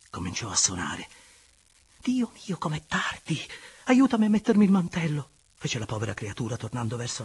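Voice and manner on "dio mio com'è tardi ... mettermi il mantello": high, urgent exclamation growing loud; hurried lover's high outcry; flustered alarm. Acted. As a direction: panic at the hour and call for help with the cloak. Flustered woman realises it is late.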